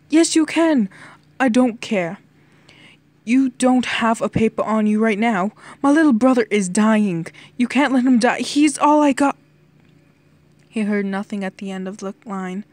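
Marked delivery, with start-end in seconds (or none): 0.1-9.4 loud, raised-pitch frantic plea; building anguished outburst; desperate shouting. A narrator's reading. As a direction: shout down the phone, begging for his life. England desperately begs the Prime Minister.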